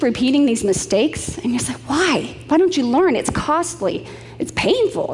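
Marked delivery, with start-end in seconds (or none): 1.9-5.1 high-pitched, swooping exasperation; acted dismay, voice pitched well up; exasperated pleading. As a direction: plead with people who never learn. Voicing exasperation at repeated mistakes.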